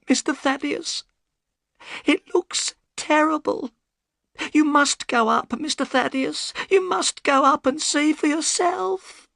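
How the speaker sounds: put-on high, pleading old woman's voice; a scared servant begging for help; frightened old housekeeper. A narrator's reading.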